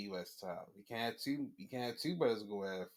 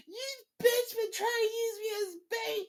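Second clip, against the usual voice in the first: high, loud whiny betrayal voice; mock betrayed wrestler; 6 dB louder than usual. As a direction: squeal it like a betrayed partner. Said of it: "they gonna realize".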